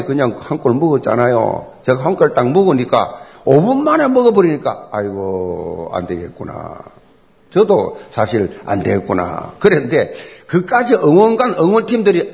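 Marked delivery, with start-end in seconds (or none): 4.9-9.5 low, drawn-out sigh of resignation; 낙담한 탄식. Retold or imitated. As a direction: give up hope, voice sinking low and soft. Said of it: "힘 다 빠져가지고". (힘 빠져 낮게 내뱉는 탄식)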